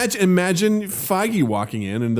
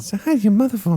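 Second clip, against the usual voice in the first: higher, put-on celebrity voice; Mark Wahlberg; 6 semitones higher, faster than usual. Actor sending greetings through Tom, higher imitated voice. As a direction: raise the pitch into an actor impression. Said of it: "this was a Mark Wahlberg a little bit".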